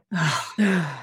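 long audible sigh out; exhale with a sigh. Shown as a demonstration. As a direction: let a big sigh go. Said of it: "exhale with a sigh".

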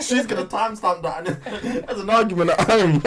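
amused, words broken by laughs; teasing laughter. Real, not acted. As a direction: tease while cracking up. Teasing friend about partner.

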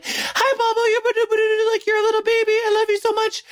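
very high, squeaky pet-owner voice; high-pitched baby talk. Retold or imitated. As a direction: gush at the dog in a squeaky mock coo. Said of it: "a high-pitched noise and a high-pitched voice".

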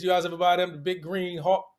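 ordinary talk

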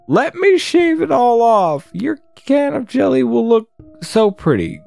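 high-pitched eager goblin voice; hammed-up creepy admirer, pitched high; Goblin King.

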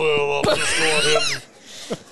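talking stomach. Pretend. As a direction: growl it like a hungry gut. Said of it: "can you do a voice for your belly button".

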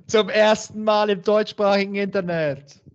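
feierliche Ansage. Acted. Cat king grand announcement.

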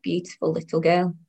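ordinary delivery, nothing marked

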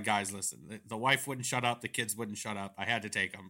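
low, quiet tired politician; lightly voiced world-weary excuse; weary Ted Cruz. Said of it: "He's like".

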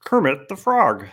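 high, slow Kermit the Frog voice; Kermit impression. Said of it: "I gotta do the Kermit the Frog first".

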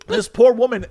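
usual speaking voice

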